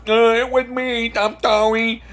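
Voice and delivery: meek, high apologetic voice; timid high apology. Pretend. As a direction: squeak out a nervous apology. Wheelchair guy voiced apologizing.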